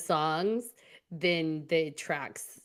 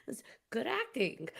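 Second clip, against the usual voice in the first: usual speaking voice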